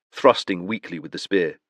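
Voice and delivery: ordinary delivery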